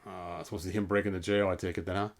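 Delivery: ordinary delivery, nothing marked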